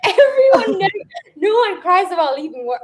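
high squealing laugh breaking into the words; tearful giggly protest; laugh-cry. Real, not acted. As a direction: laugh through it, half crying. Laughing at her own tears.

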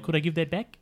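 clipped-vowel New Zealand question; New Zealand accent. Acted. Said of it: "it's like a New Zealand kind of".

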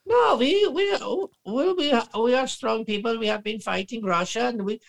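high mock accent voice; mock Ukrainian official. Mocking the Ukrainian lady on Fox, high voice. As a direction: stammer it like a flustered official. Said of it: "And she's like".

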